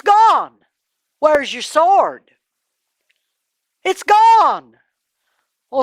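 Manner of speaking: high, loud questioning; confronting. Acted. David confronting Saul, high and loud. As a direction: press him hard.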